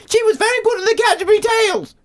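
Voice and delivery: chirpy, high mum impression; excited mum. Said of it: "my mum suddenly perked up and said".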